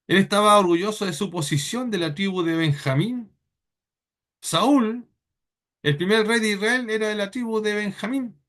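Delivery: normal talking voice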